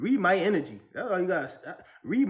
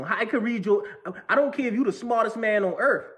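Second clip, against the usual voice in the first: normal talking voice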